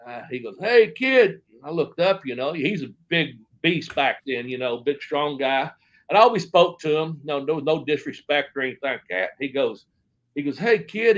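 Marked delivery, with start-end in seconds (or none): 0.6-1.4 loud, high call to a youngster; retold attention-getting holler; imitated big wrestler hailing. 10.6-11.2 raised-pitch call to a youngster; retold attention-getting call; imitated big wrestler hailing.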